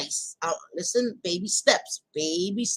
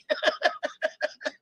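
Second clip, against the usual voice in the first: breathy laugh; 9 semitones higher than usual. Real, not acted. Laughs at viewer comment.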